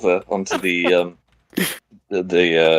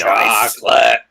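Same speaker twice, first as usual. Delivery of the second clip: stretched, savouring comic delivery; drawn-out mock quote; 3 semitones higher, slower than usual. Players riffing on an offer of something nice, drawn out. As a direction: draw the word right out.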